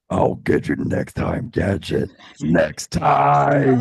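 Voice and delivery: very low, soft raspy villain growl; Doctor Claw. Retold or imitated. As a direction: growl it like a cartoon supervillain. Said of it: "that raspy voice out. You gotta be like this".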